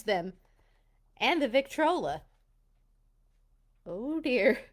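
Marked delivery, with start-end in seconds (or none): none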